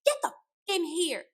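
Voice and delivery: sharp high mock yell; imitated yelling. Retelling how she screams at Troy, high mock yell. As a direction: shriek the order to come in. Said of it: "And she's like".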